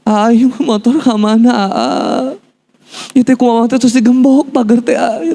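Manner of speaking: high, drawn-out mother's wail; stressed mother. Retold or imitated. Mother asks her son where the motorbike went, stressed wail. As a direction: imitate a mother wailing in Sundanese, high-pitched. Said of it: "ibu saya stres itu".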